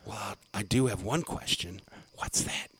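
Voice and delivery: very soft, near whisper; hushed reply. Retold or imitated. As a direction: play along with the whispering. Mock whispering back before blurting out.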